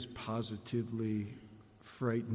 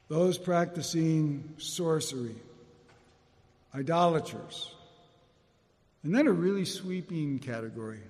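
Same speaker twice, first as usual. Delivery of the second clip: scripture reading; 4 semitones higher, 4 dB louder than usual. Acted.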